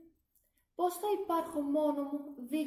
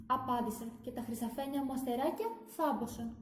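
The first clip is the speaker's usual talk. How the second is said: ordinary delivery, nothing marked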